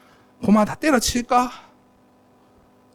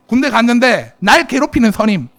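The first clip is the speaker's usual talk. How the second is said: normal talking voice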